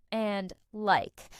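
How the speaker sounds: normal talking voice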